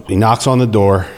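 ordinary delivery

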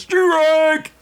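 retold out-of-nowhere yell, very high; a startling mock umpire cry; sudden shout. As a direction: blurt it like an umpire's call. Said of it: "and suddenly goes".

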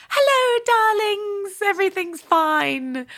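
bright brave face through tears; forced cheer, voice catching; breathy vintage purr. Retold or imitated. Mum hides tears, faces kids.

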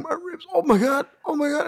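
high, strained cries of pain; pained groaning. Retold or imitated. Reliving the painful hug for a friend, high pained cries. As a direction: gasp it out like your ribs just cracked. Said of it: "Fool, like".

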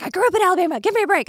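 high-pitched mock indignation, imitating a coworker; hammed-up high defensive quote; defensive retort. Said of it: "And she was like".

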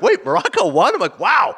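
high-pitched astonished reaction; amazed. Retold or imitated. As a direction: marvel at a huge sporting upset. Acting astonishment at Morocco's upset.

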